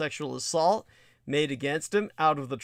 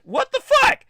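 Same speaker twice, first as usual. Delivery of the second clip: incredulous; 7 dB louder than usual. Real, not acted. Outraged disbelief at the story.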